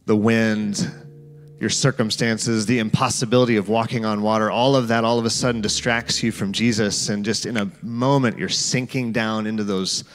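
guided scripture meditation. Acted.

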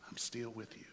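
very soft, low and tender; hushed reassurance. Acted. Preacher voicing God's promise to Israel, hushed and reassuring. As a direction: drop right down to reassure them.

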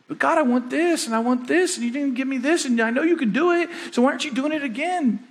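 high, pleading mock whine; whining asker. Pastor mimics a complaining believer, mock whining high. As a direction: beg God like a spoiled child. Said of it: "not just".

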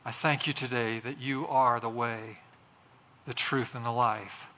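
low, measured praying voice; prayer. Real, not acted. Pastor prays to the Lord for listeners, reverent. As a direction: address God reverently, phrase by phrase with pauses.

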